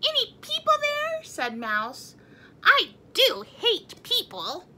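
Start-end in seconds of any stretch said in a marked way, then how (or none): 0.1-1.2 high squeaky little mouse. A narrator's reading. Mouse character voice. 2.6-4.6 high, slow grumpy mouse; squeaky mouse voice. A narrator's reading. Mouse character voice.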